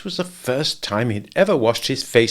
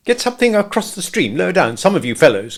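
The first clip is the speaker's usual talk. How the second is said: high, louder call; 8 semitones higher, 4 dB louder than usual. A narrator's reading. Rabbit calling to the others at the stream. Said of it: "called Rabbit".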